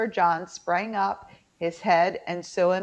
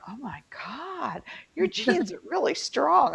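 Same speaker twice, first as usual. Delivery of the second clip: mock amazement, slow, then much higher; amazed coworkers; 7 semitones higher, 3 dB quieter than usual. Coworkers looking at her family pictures, mock amazed. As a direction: imitate coworkers gushing, slow start rising high.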